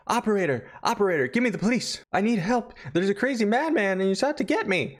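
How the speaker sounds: high, fast mock panic; panicked caller. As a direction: gasp it out to the operator. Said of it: "She says".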